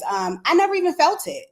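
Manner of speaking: ordinary delivery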